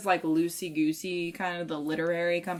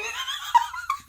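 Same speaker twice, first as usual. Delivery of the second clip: high shrieking laugh. Retold or imitated. Mimicking giddy reader.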